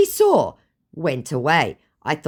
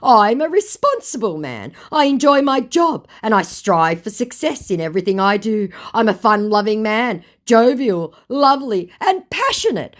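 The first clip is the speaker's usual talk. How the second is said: higher, louder hammed-up sweet talk; mock scammer voice; 8 semitones higher, 6 dB louder than usual. Imitating the scammer selling himself to her, hammed-up and higher. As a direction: ham up the scammer's smooth self-praise. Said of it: "said my scammer".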